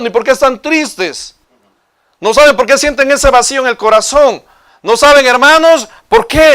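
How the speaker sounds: high-pitched, driving sermon delivery; impassioned preaching. Real, not acted. Preacher warning the congregation about lives without purpose, impassioned preaching.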